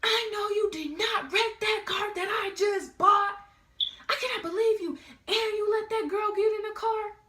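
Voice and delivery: high, loud, outraged mom voice; imitating furious mom. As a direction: yell it like an enraged mother. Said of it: "My mom calls me within five minutes".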